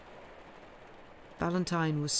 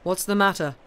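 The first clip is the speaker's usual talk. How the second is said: louder shouted question from another room; shouting; 2 semitones higher, 6 dB louder than usual. A narrator's reading. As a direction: call out from the study. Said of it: "voice was heard shouting".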